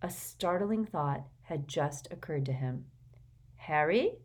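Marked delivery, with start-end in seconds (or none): none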